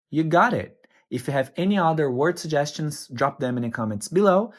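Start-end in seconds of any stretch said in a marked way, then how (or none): none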